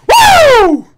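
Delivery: a huge high whooping cheer; wild woo. Real, not acted. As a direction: whoop at the top of your lungs. Celebrating the knockout.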